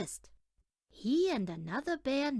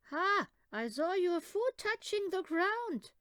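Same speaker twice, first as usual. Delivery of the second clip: mocking older brother; 8 semitones higher, 4 dB louder than usual. Brother mocks Benny.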